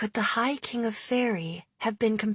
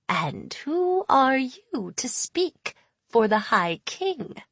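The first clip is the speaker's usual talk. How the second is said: put-on old faerie hag, proud and eerie, half whispered; ancient hag voice; 5 semitones higher, slower than usual. A narrator's reading. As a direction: claim her age with eerie menace. Hag questions Jude coldly.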